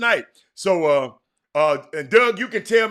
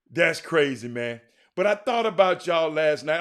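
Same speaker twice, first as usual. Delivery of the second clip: usual speaking voice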